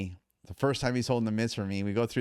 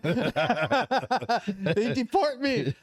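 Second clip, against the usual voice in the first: put-on higher, louder, drawn-out Thai accent; imitating Thai trainer, very slow; 13 semitones higher, 4 dB louder, much slower than usual. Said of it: "he goes".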